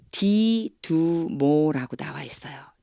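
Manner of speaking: ordinary talk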